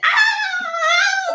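high, loud frightened shriek; mock scream. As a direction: shriek like a scared kid at the belt. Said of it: "she's like".

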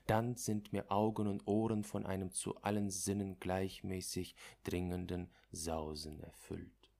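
plain reading voice

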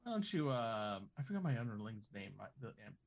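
ordinary talk

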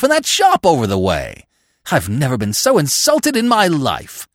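high, loud indignant tirade; raving. A narrator's reading. McTodd complaining of his lunch host, raving. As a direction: rave on, piling grievance on grievance. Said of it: "raved Mister McTodd".

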